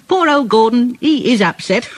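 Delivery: high-pitched, cheeky little engine; mock pity between chuckles; chuckling Thomas. A narrator's reading. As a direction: tease in a high, gleeful voice. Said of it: "Thomas chuckled".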